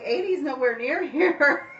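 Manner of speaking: incredulous laugh through the words; laughing retort. Retold or imitated. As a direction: laugh it off in disbelief.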